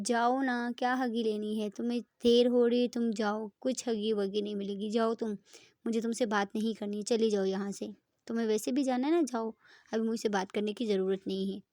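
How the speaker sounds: loud, sulky telling-off; loud jealous girlfriend sending her away; angry sulk. Acted. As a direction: push her away in a huff, loudly. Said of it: "उस बहुत ज़्यादा गुस्से में होती है".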